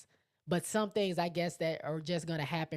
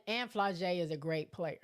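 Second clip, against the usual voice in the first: usual speaking voice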